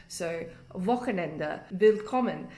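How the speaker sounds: "Wochenende, willkommen" with the W said as a V; W spoken as a German V sound. Shown as a demonstration. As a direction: say "willkommen" as "villkommen". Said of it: "it's all with the V sound".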